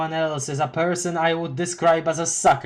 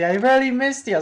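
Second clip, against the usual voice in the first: much higher, gushing greeting; 10 semitones higher, 4 dB louder than usual. Acted. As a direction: gush over an old buddy. Affectionate character greeting.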